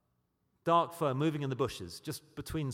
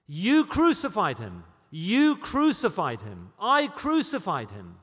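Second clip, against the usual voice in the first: much louder, slow, each word struck; solemn loud accusation; 4 semitones higher, 10 dB louder, slower than usual. Acted. Preacher confronting the congregation with their guilt, loud and slow. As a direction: declare it like a verdict. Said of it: "I am bound to say to you".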